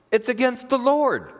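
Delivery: "Lord" high and drawn out; slow, high punch on "against the Lord"; emphatic rebuke. Acted. Moses correcting the grumbling people, high drawn-out stress on "Lord".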